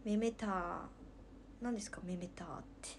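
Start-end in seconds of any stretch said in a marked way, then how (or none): 1.6-2.0 whispered assistant. Retold or imitated. Assistant voice reply.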